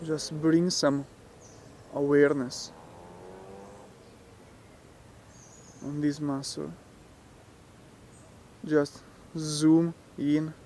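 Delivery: soft, unhurried meditation voice with long pauses; guided meditation. Real, not acted. Teacher talking listeners through relaxing the muscles, guided meditation. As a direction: guide them gently, leaving space after each phrase.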